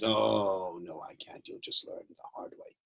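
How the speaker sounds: whispered, low menacing hint; creepy hushed warning; ominous whisper. Pretend. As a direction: whisper it like a dark secret. Ominous unspecified threat.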